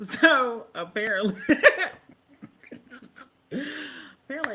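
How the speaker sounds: high, loud, through laughter; words breaking into real laughter; laughing while talking. Real, not acted. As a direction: try to start through a laughing fit. Trying to speak after laughing fit.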